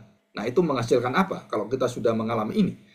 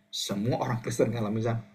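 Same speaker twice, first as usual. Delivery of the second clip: ordinary talk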